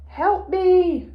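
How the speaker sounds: "Help me!" shouted high and loud, urgent. Shown as a demonstration. Teacher to pupils, shouting "Help me!" as an urgent cry. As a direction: cry out "Help me!" as if calling for rescue. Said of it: "it to be shouted out".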